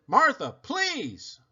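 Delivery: slow, high, drawn-out plea; pleading exasperation. Retold or imitated. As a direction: beg her to stop, slowly.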